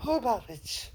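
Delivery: very high, fast, airy fairy voice; sing-song good witch, high and sweet; Glinda the Good Witch. Retold or imitated. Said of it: "I can also do Glinda the Good Witch".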